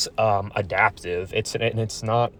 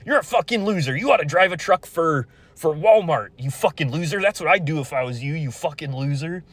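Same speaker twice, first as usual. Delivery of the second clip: mocking abusive dad; 7 semitones higher than usual. Son imitating his father's insults, high mocking yell. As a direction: ham up the father screaming insults. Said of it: "he'll, like, be like".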